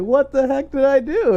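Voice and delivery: high, loud, baffled squeak; a high yelp of disbelief; surprised. Retold or imitated. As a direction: act out being baffled. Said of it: "I was like".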